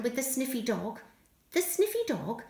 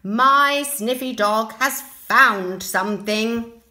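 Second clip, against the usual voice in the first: louder, slow proud voice; confident man; 9 dB louder, slower than usual. Acted. Man reassuring the searchers, slow proud voice. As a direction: announce it proudly. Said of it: "said the man with the sniffy dog".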